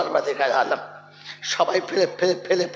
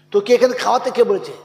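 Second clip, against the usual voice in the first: climbing higher and louder each question; building to a shout; 10 semitones higher, 10 dB louder than usual. Acted. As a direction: rebuke rising to full fury. Building rebuke. (ক্রমশ চড়া গলায় ধমক)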